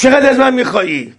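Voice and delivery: high, laughing taunt; mocking laugh. Creditor laughing at Ammar while asking how much, high mocking laugh. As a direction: sneer the question through a laugh.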